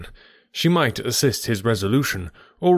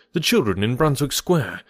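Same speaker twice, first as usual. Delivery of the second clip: ordinary delivery, nothing marked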